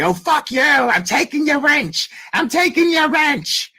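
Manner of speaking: high, mocking argument voice; mocking MGL. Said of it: "getting in an argument with somebody".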